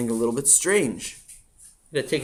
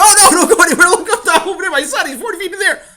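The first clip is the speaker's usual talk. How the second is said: high, loud, half laughing; frantic laughing parent; 12 dB louder than usual. Acted. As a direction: point the firemen up at the kid.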